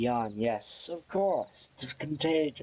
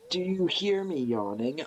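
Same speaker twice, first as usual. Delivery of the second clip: ordinary delivery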